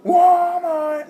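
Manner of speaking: high, loud shouted Irish impression; put-on Irish trash-talk shout; mock Irish accent. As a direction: yell it in a bad Irish accent. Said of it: "almost start talking in an Irish accent".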